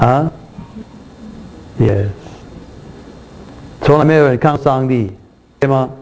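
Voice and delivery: normal talking voice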